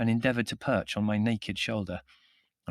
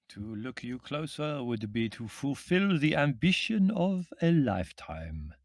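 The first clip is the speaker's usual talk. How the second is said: put-on slow, accented, ceremonious voice; small foreign man; 4 semitones higher, slower than usual. A narrator's reading. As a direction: intone a grand compliment in a thick accent. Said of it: "said the small man".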